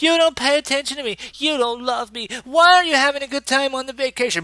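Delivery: high, loud and fast accusing whine; mock whiny quarrel. Dax nagging Worf during their vacation argument, hammed-up high whine. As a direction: whine at him like a neglected partner.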